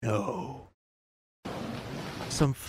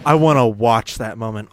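"I wanna watch" hit loud, then easing off; mock her odd emphasis, punching "I wanna watch"; loud mocking echo leaning on "I wanna watch"; mimicking a news anchor; 8 dB louder than usual. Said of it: "Why'd she say it like that?".

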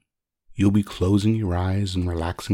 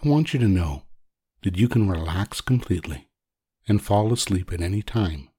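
ordinary talk, nothing marked

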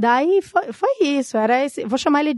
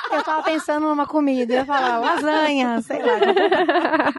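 laughing; 4 semitones higher than usual. Real, not acted. Joking answer while laughing.

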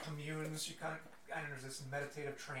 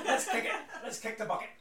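loud Scottish dwarf, laughing; Scottish accent; 8 semitones higher, 8 dB louder than usual. Acted. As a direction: laugh through a Scottish rally cry. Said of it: "So he's like".

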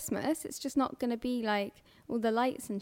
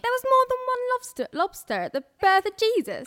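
put-on much higher, louder kid voice; child voice quote; 11 semitones higher, 6 dB louder than usual. Friend quoting the nativity kid, high and loud. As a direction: pipe up like an excited child.

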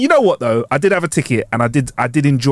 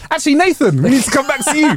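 imitating comedian; 11 semitones higher than usual. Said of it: "they were like".

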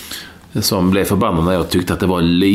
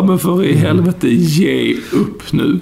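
high, slow fed-up cry of "give up"; mock-exasperated, dragging out "ge upp nu"; exasperated outburst; 9 semitones higher, 3 dB louder, much slower than usual. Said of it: "Ja, jag bara". (uppgivet utbrott, högt och långsamt)

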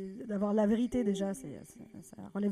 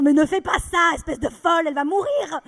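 higher, loud objection; panicked protest; 7 semitones higher, 11 dB louder than usual. Retold or imitated. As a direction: beg her to stop. (protestation paniquée, forte)

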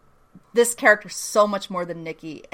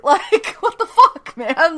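laughing disbelief; 10 semitones higher, 8 dB louder, slower than usual. Real, not acted. Disbelief at Malski's choice.

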